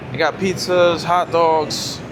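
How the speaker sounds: ordinary delivery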